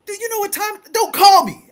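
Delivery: high and loud telling-off on the phone; retold yell. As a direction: bark it down the phone line. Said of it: "I'm like".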